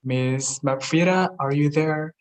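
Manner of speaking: ordinary delivery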